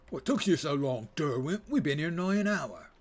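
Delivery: badly faked working-class British accent. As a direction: play a rough British lout, hammed-up. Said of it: "This is my bad, uh, lower class British accent".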